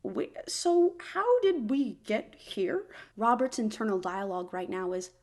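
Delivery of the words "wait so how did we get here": baffled. Real, not acted. Confused by plot turn.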